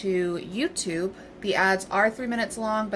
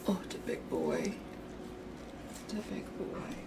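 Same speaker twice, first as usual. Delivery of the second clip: whispered, much softer cooing; soft whisper to dog; 11 dB quieter than usual. Real, not acted.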